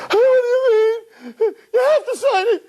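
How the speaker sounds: very high wailing with sobs between phrases; hammed-up tearful squeal, slow and loud; mock crying. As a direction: imitate a girlfriend bawling and pleading. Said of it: "she starts crying".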